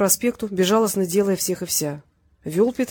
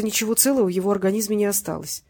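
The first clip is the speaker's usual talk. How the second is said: ordinary delivery, nothing marked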